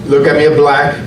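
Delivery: usual speaking voice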